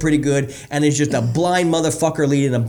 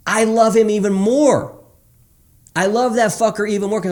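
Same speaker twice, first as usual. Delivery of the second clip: passionate; 8 semitones higher, 4 dB louder than usual. Real, not acted. Passionate about the client.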